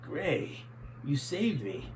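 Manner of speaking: soft, weak and breathless after nearly drowning; a hint of a half-drowned general; weak sputter. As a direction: barely get the words out. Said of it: "sputtered and spit out".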